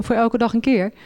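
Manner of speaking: ordinary talk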